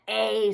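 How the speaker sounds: A said as a long drawn "ay"; A spoken slowly as "ay"; long A sound. Shown as a demonstration. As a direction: stretch the A into a clear long "ay". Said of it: "the A says the long sound".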